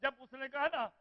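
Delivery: full-force majlis wailing; preaching lament. Acted. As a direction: mourn it aloud to the gathering.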